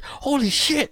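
a retold scream of fright, high-pitched; mock terrified yell. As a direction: yelp it like robots just burst in. Retold terror before waking.

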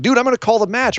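high, exasperated warning; lightly voiced fed-up ref; warning referee. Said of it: "is like".

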